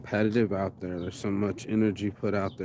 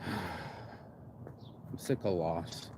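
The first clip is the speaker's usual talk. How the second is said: slow, much softer, heavy and worn out; weary sigh; 4 semitones lower, 8 dB quieter, much slower than usual. Real, not acted. Tired of friends dying, a slow soft sigh. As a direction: let it sag out on a sigh.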